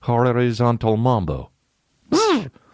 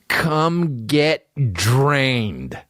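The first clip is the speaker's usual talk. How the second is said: slow robot read; 4 semitones higher, much slower than usual. Retold or imitated. Robot ad line.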